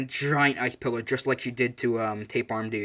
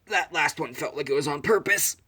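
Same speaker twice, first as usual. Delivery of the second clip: strained voice, as if hurt; pained strain; 3 semitones higher than usual. Retold or imitated. Fighter just hit in the groin, pained complaint. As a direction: squeeze it out through mock pain.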